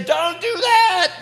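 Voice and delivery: loud, high, slow warning; urgent warning. Retold or imitated. Teller stops the wife from hitting the pilot, loud, high and slow. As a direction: warn her loudly and high, word by word.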